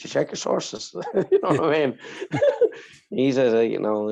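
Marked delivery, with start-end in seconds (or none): 2.0-2.8 squeaky breathless laugh burst; high giggle. Real, not acted. Laughing while telling a friend the story. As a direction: let a high laugh escape.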